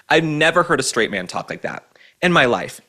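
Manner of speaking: ordinary delivery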